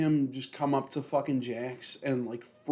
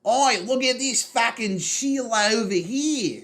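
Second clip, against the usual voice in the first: broad mock Australian, high, loud and drawn out; Kano; 9 semitones higher, 6 dB louder than usual. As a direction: holler it slowly in a cartoon Aussie accent. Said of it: "they make Kano really fucking Australian".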